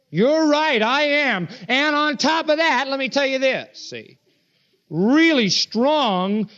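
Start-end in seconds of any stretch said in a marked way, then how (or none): 0.1-3.8 high-pitched, insistent quoted retort; emphatic. Retold or imitated. Preacher voicing Christ doubling down on his claim, emphatic. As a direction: press each phrase home up high. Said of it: "he gets more emphatic".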